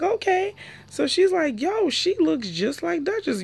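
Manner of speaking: high gossipy imitation; imitating Sky. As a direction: pitch it way up like a gossiping friend. Imitating Sky's reaction.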